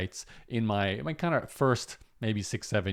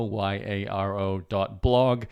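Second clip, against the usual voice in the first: slow, letter-by-letter spelling of "Y A R O dot blog"; spelled out slowly; slower than usual. Real, not acted. Giving viewers his newsletter web address, spelled out slowly. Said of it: "It's at Yarrow".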